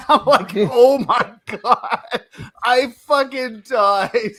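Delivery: high and loud, laughing through the words; cracking up mid-story; slow, breaking up with real laughter. Real, not acted.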